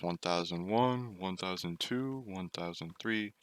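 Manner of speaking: imitating mom counting too slowly. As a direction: count the seconds slow and quiet. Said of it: "she counts like this".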